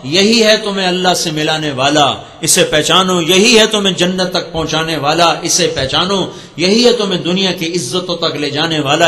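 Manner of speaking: rhythmic, emphatic refrain preaching. Acted. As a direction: drive each refrain home to the crowd. Building sermon proclamation. (واعظ کا جوشیلا انداز)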